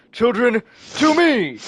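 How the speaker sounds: high, rising rallying cry; commanding call. Acted. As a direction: summon your troops across a battlefield. Villain calls his children to attack.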